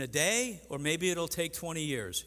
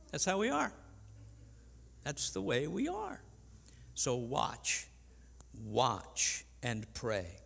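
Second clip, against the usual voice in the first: ordinary talk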